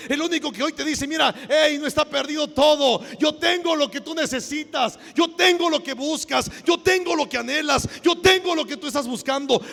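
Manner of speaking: loud, high, fervent sermon delivery; impassioned pulpit cry; full-force preaching. Acted. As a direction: proclaim it to the whole congregation, stretching key words.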